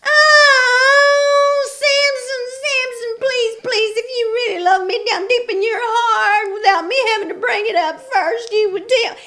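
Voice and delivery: very high, loud nagging whine; shrill whining Delilah. A narrator's reading. Delilah nagging Samson to tell her, loud shrill whine. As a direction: nag him, high and relentless. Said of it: "to whine".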